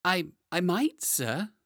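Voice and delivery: high, stammering and polite; nervous polite. Acted. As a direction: stammer politely to a dangerous customer. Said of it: "said John politely".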